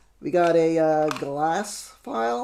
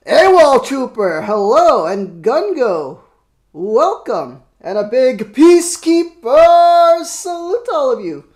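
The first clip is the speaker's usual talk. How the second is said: loud, high, manic greeting; crazy voice; 7 semitones higher, 12 dB louder, slower than usual. Pretend. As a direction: bellow the welcome like a maniac. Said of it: "That's my crazy voice".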